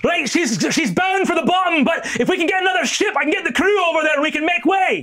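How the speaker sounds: high-voiced captain, quick and urgent; ship captain Annabelle. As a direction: rattle off the plan, fast and high.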